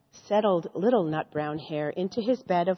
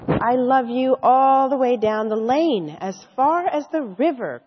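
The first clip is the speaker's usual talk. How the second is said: louder eager little hare call; crying out; 3 semitones higher, 6 dB louder than usual. Acted. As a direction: call it out with all your might. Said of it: "cried Little Nut Brown Hare".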